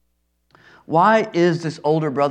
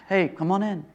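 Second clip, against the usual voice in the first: casual ask; 2 semitones higher, 6 dB quieter than usual. Retold or imitated. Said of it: "This wasn't a simple ask".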